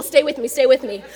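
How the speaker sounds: rally the room like an excited preacher; Russell-style hype. Acted. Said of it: "I sound like Russell".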